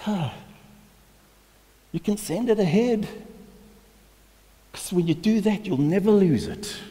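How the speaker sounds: high, loud, driving preacher's climax; fiery pulpit delivery, well above normal pitch; preaching at full force. Acted. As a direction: preach it out hard to the congregation.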